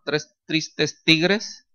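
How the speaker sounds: tongue twister said very slowly and deliberately; joking drawn-out tongue twister; mock tongue twister. Pastor joking about the hard verse, drawn-out tongue twister. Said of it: "aquí viene uno de los trabalenguas".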